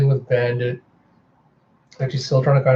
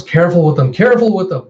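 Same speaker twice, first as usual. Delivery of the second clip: raised voice, climbing higher; louder, insistent caution; urgent warning; 5 semitones higher, 6 dB louder, faster than usual. Retold or imitated. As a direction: warn them loudly to be careful. Healer warns the handlers.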